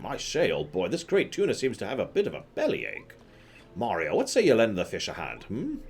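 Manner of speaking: mock British explorer with lilting pitch; Colorado, jaunty. Explorer asking Mario to help the fish, jauntily British. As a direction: suggest it airily, ending on a high query.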